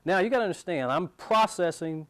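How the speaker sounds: ordinary talk, nothing marked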